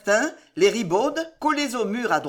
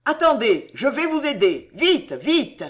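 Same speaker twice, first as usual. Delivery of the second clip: high, loud and eager; urgent shouting; 6 semitones higher, 5 dB louder than usual. A narrator's reading. Ruggieri to soldiers behind the barred windows, shouting urgently. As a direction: urge them on with raised voice.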